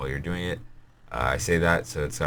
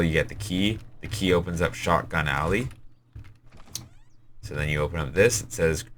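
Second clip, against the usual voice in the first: ordinary talk, nothing marked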